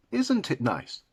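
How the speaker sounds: lightly demonstrated proper English accent. Shown as a demonstration. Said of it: "Proper English people would say".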